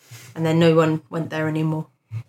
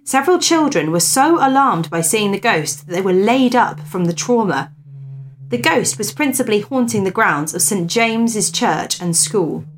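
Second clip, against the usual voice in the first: lifted, measured storyteller's voice; story narration; 4 semitones higher than usual. Acted. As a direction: read the old ghost tale aloud with emphasis. Reads a ghost story aloud.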